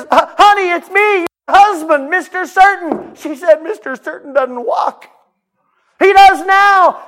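high, loud, slow comic voices; husband and wife joyfully arguing; comic couple dialogue. Acted.

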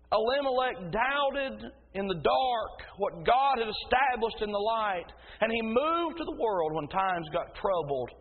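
punchy, ringing pulpit delivery; forceful preaching. Real, not acted. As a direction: drive each phrase home like a preacher.

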